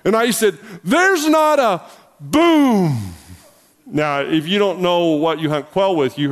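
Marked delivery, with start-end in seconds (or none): 0.9-1.8 raised, high-pitched retort cut off; calling out. Retold or imitated. Pastor retelling his answer to a hunting friend, calling out. 2.2-3.1 big drawn-out "boom" falling away; imitated gunshot. Pastor acting out the shot in the brush, booming imitation.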